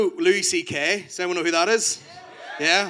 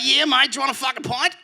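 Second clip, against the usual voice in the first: higher, laughing mock Australian; Australian accent; 8 semitones higher than usual. Australian dude repeating the offer, mock Australian accent. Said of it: "He's like".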